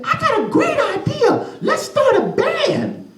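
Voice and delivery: put-on very high child voice; high eager boy; excited kid. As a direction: pitch the band idea eagerly. Little Miles speaks.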